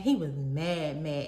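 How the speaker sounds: slow, low stress on "mad, mad"; "mad, mad" said slowly and weightily for emphasis; emphatic "mad, mad". Real, not acted. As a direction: drop the pitch and draw out each "mad". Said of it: "when I say he was mad".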